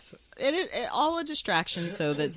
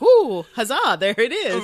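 mock Cordelia; 5 semitones higher, 11 dB louder than usual. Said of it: "Cordelia was like".

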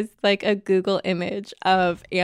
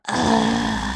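low, disgusted ugh; disgusted groan. Retold or imitated. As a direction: groan it out, annoyed. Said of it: "And I was like".

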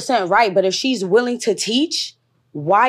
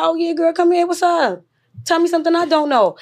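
put-on friendly girl talk; 8 semitones higher than usual. Voicing herself greeting sisters.

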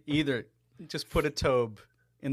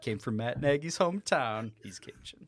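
ordinary delivery

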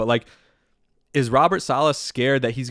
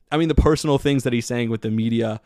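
usual speaking voice

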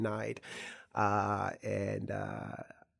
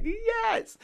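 high excited yelp of joy; cheering; 6 dB louder than usual. Retold or imitated. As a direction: shout it like you just won. Family cheering about going to Australia.

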